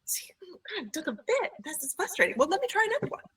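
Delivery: teacher faking annoyance to show the mistake; mock frustration. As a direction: pretend to fumble for the child's benefit. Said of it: "and be like".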